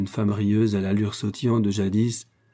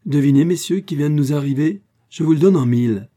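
higher and louder, teasing announcement; excited cry; 6 semitones higher, 7 dB louder than usual. A narrator's reading. Lucienne calling to the men at breakfast, playful excited cry. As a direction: call out the guessing game with glee. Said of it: "l'entendit qui s'écriait".

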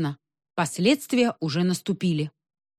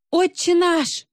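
high-pitched howled prayer; panicked wail; 11 semitones higher, 3 dB louder, slower than usual. A narrator's reading. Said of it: "завопила я".

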